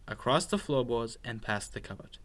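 plain reading voice

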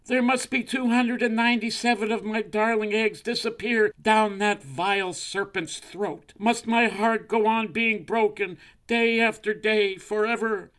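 high, loud melodramatic lament; sustained high-pitched outrage; screaming crow wife. A narrator's reading. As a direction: wail theatrically as a wronged mother crow. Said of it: "screamed Missus Crow".

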